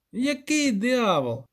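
high, drawn-out disbelieving question; incredulous poet. Acted. Poet challenging the village elder, high and incredulous. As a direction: stretch it out in disbelief.

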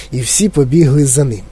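usual speaking voice

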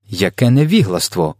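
ordinary delivery, nothing marked

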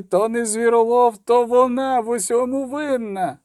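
high, drawn-out howl of accusation; wailing. Acted. Market woman blaming the girl for her dog, wailing. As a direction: keep wailing high while rousing the crowd. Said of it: "завила раптом торговка".

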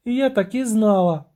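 high, thin siren exclamation; thin cry. Acted. Siren takes the refusal badly, thin high cry. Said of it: "тоненько крикнула сирена".

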